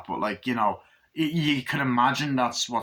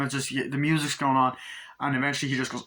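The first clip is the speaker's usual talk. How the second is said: normal talking voice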